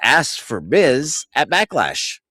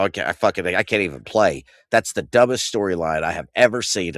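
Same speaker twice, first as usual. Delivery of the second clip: usual speaking voice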